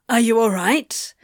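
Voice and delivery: urgent question with a rising end; alarmed yelp. A narrator's reading. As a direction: check on them in a fluster. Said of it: "The demon yelped".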